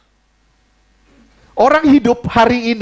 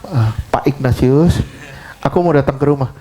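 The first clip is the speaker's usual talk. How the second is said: Jokowi; 10 semitones lower than usual. Retold or imitated. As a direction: play the president announcing a visit, half laughing. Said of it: "misalnya ada Jokowi mau datang". (suara tiruan tamu penting, sambil tertawa)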